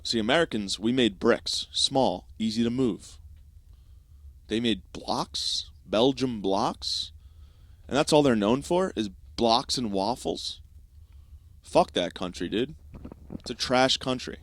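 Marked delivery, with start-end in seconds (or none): 4.5-10.6 mock slow drawl. Mocking Belgium, slowed.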